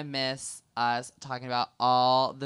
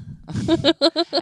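loud high burst of laughter; 11 dB louder than usual. Real, not acted. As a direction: crack up loudly at her. Big laugh at partner.

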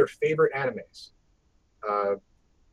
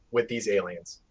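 normal talking voice

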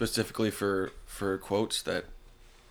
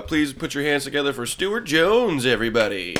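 grand entrance announcement; 4 semitones higher, 5 dB louder than usual. Acted. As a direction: build up the guest like a showman. Introducing the special guest.

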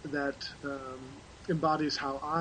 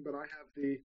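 normal talking voice

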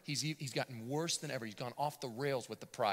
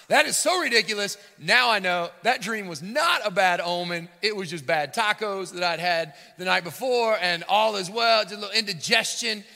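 loud, high swaggering king voice; boastful monarch dismissing his fears; cocky relieved king; 7 semitones higher, 13 dB louder than usual. Retold or imitated. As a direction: play the king shrugging off the dream.

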